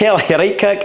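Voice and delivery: a light, high-pitched attempt at a Scottish accent, half laughing. Retelling the Coventry manager telling him, in a light mock Scottish accent. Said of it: "Go on".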